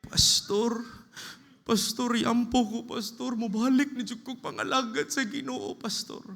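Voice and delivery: high, loud pleading; dying man begging his pastor for another chance; desperate plea. Pretend. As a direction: beg the pastor with everything.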